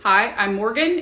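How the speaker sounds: ordinary talk, nothing marked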